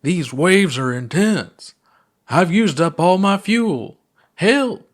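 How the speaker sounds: high, loud, slow hammed-up cry for help; mock distress. Puppet pilot out of fuel calls for help, hammed-up distress. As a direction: play a drowning toy pilot.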